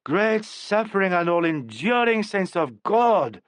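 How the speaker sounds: high, slow, hammed-up plea to the saints; exasperated appeal. A narrator's reading. Dresser appeals to heaven over the hysterical actress, exasperated. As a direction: cry out to heaven in mock despair. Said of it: "apostrophized Jacko".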